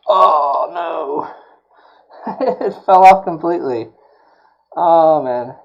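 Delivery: loud, high laughing dismay; dismayed outburst. Real, not acted. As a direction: blurt it as it falls apart. Part fell off completely.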